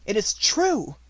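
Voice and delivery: high, loud, amazed disbelief; can't believe her luck. Acted. Finding the coin, amazed.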